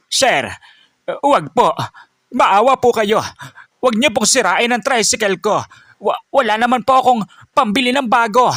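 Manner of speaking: put-on old driver begging the bully, high and pleading; pleading old man. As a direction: plead desperately not to wreck the tricycle. Old man begs the tanod.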